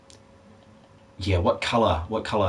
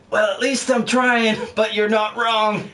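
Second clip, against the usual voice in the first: put-on voice; 8 semitones higher than usual. Streamer answering a tease, high put-on voice. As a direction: pitch it up high and stretch it out.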